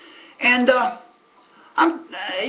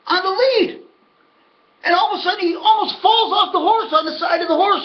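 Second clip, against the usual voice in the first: excited retelling; 6 semitones higher than usual. Real, not acted.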